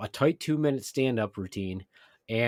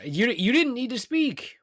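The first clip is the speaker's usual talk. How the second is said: mock indignant; 11 semitones higher than usual. Real, not acted. Intro banter before show.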